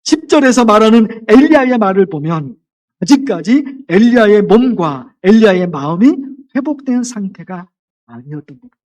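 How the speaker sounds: ordinary delivery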